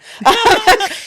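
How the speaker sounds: high, loud laugh; burst of laughter. Real, not acted. Joking about having no secret account, real loud laugh. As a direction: break out laughing at your own aside. Laughs after own aside.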